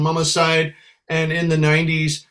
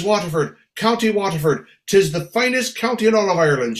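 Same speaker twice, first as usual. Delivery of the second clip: emphatic Irish brogue, a bit higher; proud Irish lady; 4 semitones higher than usual. Acted. Little lady naming her county to the singer, proud Irish brogue. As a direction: declare it proudly in an Irish lilt. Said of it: "She says".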